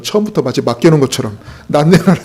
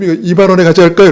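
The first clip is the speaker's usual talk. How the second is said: eager buyer; 5 semitones higher, 8 dB louder, faster than usual. Retold or imitated. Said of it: "그럼".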